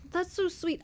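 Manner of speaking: shorter high-pitched gush; quick high gush. Real, not acted. Vlogger gushing again about the romance, quick high gush. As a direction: repeat it bright and fast.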